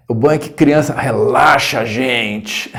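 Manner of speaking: slow, strained imitation of an instructor; tense "relaxing" impression. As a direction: drag out "relaxa" with mock tension. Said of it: "ele falava com essa entonação".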